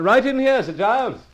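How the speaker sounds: calling out. Acted. Carson calls Sir Giles in through the door. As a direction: call him in from across the room.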